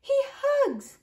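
put-on soft, very high child's voice; little niece, wistful. A narrator's reading. Niece telling uncle what daddy does, small voice.

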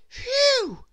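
high, loud relieved phew; relieved boy. A narrator's reading. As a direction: blow out the relief. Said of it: "said Jack".

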